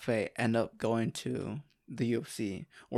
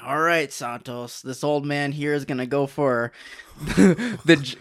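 louder, pumped-up buddy boasting; hyped-up teammate voice; 3 semitones higher, 8 dB louder than usual. Retold or imitated. Quoting big training partner.

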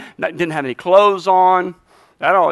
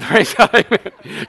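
usual speaking voice

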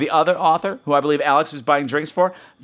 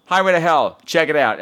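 ordinary talk, nothing marked

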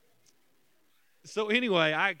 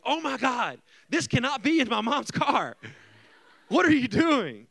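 loud, high-pitched alarmed outburst; mock panic at full volume; panicked; 10 semitones higher, 9 dB louder than usual. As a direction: act out a teenager panicking, loudly. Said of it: "I literally panicked".

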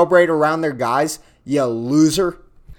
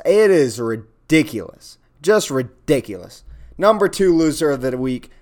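ordinary talk

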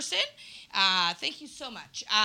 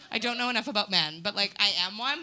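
usual speaking voice

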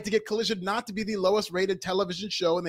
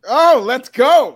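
slow, much louder hyped cry; hype shout; 4 semitones higher, 12 dB louder, much slower than usual. Acted. Departing co-host kicking off his last news segment, loud hype. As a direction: pump up the room.

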